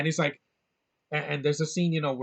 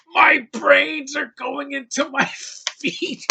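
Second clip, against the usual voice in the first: much higher, louder and slower, laughing through it; goofy villain quote; 12 semitones higher, 10 dB louder, slower than usual. Retold or imitated. Reviewer retelling the ludicrous speed scene, high, loud and laughing. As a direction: ham up the dazed villain, breaking into a laugh. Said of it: "Rick Moranis' Dark Knight was like".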